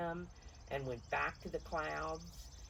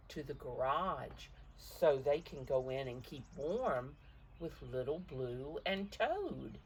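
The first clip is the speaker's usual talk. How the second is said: ordinary delivery, nothing marked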